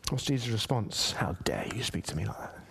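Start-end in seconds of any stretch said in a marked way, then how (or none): 1.1-2.6 hushed mock rebuke. Imagined harsh Jesus reply.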